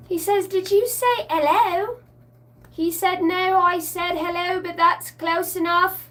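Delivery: high, slow little creature voice; worm voice. Retold or imitated. Quoting the labyrinth worm to viewers, high and slow. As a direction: play the tiny polite worm. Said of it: "What does he say in the labyrinth?".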